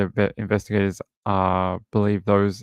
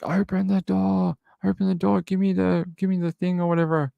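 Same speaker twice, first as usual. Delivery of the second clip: imitated yelling; 9 semitones higher than usual. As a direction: act out yelling at a door without raising the volume. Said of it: "all of a sudden I hear yelling".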